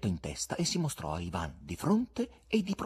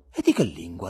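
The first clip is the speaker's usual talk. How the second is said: a quick burst of curiosity, louder; interested interruption; 3 semitones lower, 7 dB louder than usual. A narrator's reading. Ivan interrupting the guest's account, curious and loud. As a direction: cut in loudly with interest. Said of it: "lo interruppe Ivan interessato".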